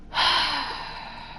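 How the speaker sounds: long voiceless exhale; heavy sigh. Real, not acted. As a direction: let out a long, airy sigh. Bracing for the timed challenge.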